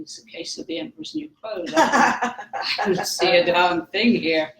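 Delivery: amused disbelief, laughing it out; giggling that she sees nothing at all. Real, not acted.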